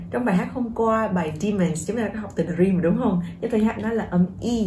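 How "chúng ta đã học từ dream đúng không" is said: ordinary talk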